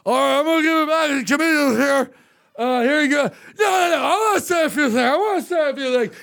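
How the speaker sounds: high, loose imitation of a drunk man; drunken rambling. Drunk chamber head grabbing the mic from the comedian, rambling. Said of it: "rambling drunk".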